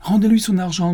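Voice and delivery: loud, high outburst; furious order. Acted. Commissioner beside himself ordering the money returned, angry. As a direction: fling the command at your clerk.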